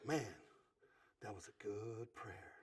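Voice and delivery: soft whisper right into his ear; hushed whispered compliment to husband. Retold or imitated. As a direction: lean in and whisper the praise. Lean over into his ear.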